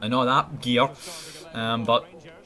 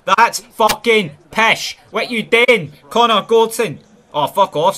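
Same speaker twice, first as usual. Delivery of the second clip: loud and much higher, spat out at the screen; furious outburst; 7 semitones higher, 9 dB louder than usual. Real, not acted. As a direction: rage at the defender's mistake. Player blunder, angry outburst.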